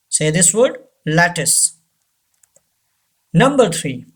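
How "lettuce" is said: lattice as "LAT-iss", ending in a short i; lattice, stressed first, closing on "iss"; second T silent. Shown as a demonstration. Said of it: "U gives the sound of E".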